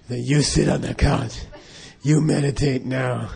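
slow, lightly voiced Dirty Harry order; a hint of a tough cop giving a command. The Lama ordering the student onto the couch, Dirty Harry style. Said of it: "He talked just like Dirty Harry".

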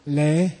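medium-length 'Le'; middle length. Shown as a demonstration. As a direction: hold the vowel of 'Le' a little. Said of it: "three different lengths of it".

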